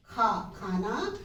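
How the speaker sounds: खा stretched slowly, then खाना; slow kha demo. Shown as a demonstration. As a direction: draw out खा with aspirated kh. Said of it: "ये हमारी हिंदी में है ख".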